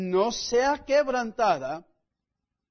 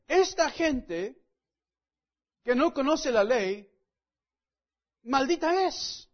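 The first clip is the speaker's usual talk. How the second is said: slow, higher-pitched, drawn-out verdict; deliberate, raised-pitch condemnation; condemning pronouncement; 4 semitones higher, slower than usual. Acted. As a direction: pass sentence on the crowd like a judging Pharisee. Acting out Pharisees' instant condemnation.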